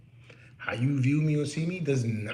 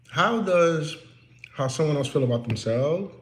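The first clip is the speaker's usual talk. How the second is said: ordinary talk, nothing marked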